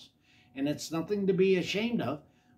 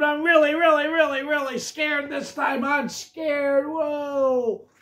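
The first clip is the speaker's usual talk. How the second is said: high, loud panicked cries; terrified scream; 12 semitones higher, 7 dB louder than usual. A narrator's reading. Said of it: "Jim screamed".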